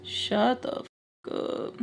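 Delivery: normal talking voice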